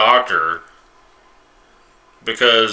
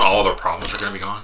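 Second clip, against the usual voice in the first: ordinary talk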